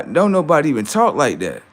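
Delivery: usual speaking voice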